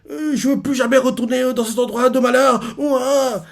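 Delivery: high, tearful sobbing plea; crying child. Retold or imitated. Young Kuma begging never to go back, sobbing. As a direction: beg through sobs, voice up high.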